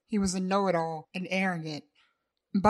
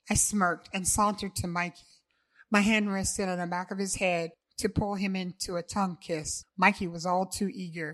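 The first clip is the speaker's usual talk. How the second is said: usual speaking voice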